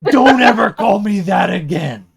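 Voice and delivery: loud, high indignant shout; hammed-up furious warning; mock outrage. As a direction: roar it as an offended demon. Offended demon voiced in retelling.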